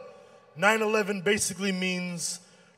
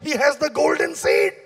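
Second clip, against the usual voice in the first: put-on Indian-Pakistani accent; 5 semitones higher, 4 dB louder than usual. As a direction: exclaim in awe with a hammed-up Indian-Pakistani accent. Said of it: "When I tell Indian Pakistani people".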